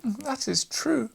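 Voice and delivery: high, slow put-on voice for the resolute watchmaker; a character voice lifted high and slowed down; resolved reply. A narrator's reading. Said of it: "replied Drosselmeyer, greatly resolved".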